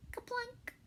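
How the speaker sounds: high, soft plunk; a tiny high berry drop; kaplunk. A narrator's reading. Sound effect word in story.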